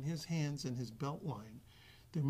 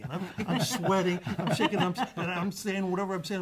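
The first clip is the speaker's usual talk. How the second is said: laughing it out, louder and higher; nervous laughter; 4 semitones higher, 9 dB louder than usual. Real, not acted. Guest recalling sweating in the simulator, laughing. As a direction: laugh through the memory of the fear.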